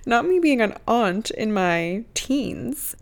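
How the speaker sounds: slow, drawn-out teen disbelief at herself, lightly voiced; playing her shocked younger self; mock teen drama. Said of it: "I was like".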